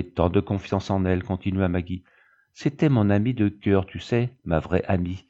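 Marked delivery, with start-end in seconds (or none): none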